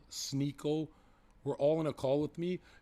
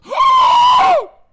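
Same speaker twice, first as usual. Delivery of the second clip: sharp high shocked gasp; hammed-up gasp; loud mock gasp; 21 dB louder than usual. Gasp after traumatize line.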